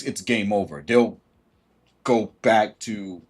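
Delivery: ordinary talk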